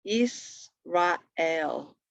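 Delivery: ISRAEL in separate slow syllables, is-ra-el; broken down. Shown as a demonstration. Teacher breaking down a word for students, "Israel" syllable by syllable. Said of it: "broken down word for Israel".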